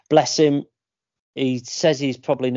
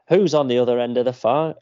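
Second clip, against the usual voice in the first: ordinary talk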